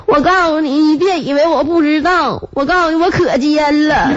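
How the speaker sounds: high, tearful mock accusation; crying while talking. Feverish wife telling husband she's no fool, tearful mock whine. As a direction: draw it out through fake sobs. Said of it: "我一边哭一边说".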